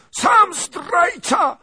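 put-on very high, slow, loud screech of an old woman; shrieking shout. Princess screams back at the lieutenant. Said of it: "krzyknęła kniaghini".

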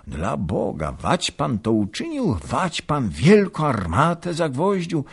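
astonished question. A narrator's reading. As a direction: ask it amazed, going up. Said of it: "Pytano ze wszystkich stron".

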